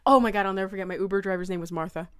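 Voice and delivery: ordinary talk, nothing marked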